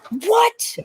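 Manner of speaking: high, loud shocked what; sudden disbelieving yelp. Real, not acted. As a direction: blurt it out in disbelief. Shocked at teacher's reading.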